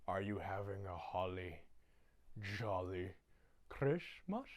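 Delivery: very soft, halting put-on menace; menacing Grinch. Grinch catching Max dancing, quiet mock menace. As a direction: ask it softly, pausing before each word. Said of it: "and he goes".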